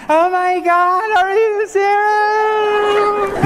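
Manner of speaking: high, squealing disbelief, drawn out slowly; overjoyed woman. Retold or imitated. Man imitating a woman proposed to, high and excited. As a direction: gasp it out like a stunned bride. Wife receiving marriage proposal.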